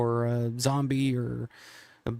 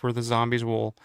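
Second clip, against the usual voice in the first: normal talking voice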